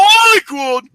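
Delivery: high, loud, put-on voice; silly high squeal of the phrase; mock echo. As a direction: parrot the line in a silly voice. Mock echo of story line.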